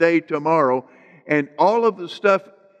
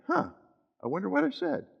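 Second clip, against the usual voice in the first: quiet, higher-pitched wondering aloud; musing to oneself; 8 semitones higher, 9 dB quieter, faster than usual. Acted. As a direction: wonder softly to yourself, pitch lifting. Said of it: "then you can just lay there and think".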